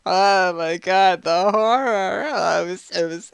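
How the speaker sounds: loud, high, drawn-out wailing; mock crying. Teller reliving falling in the rapids, hammed-up loud wail. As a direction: wail it melodramatically, stretching every word. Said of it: "I'm screaming and crying".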